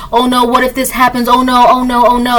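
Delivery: lightly hammed-up worried fretting; mock anxiety. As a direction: fret in mock worry, repeating it anxiously. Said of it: "all up in your feelings and worried and anxiety".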